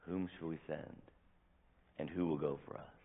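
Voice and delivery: God musing quietly to himself, overheard by Isaiah; overheard murmur. Shown as a demonstration. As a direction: say it to yourself, just overheard. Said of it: "a conversation God is having with himself".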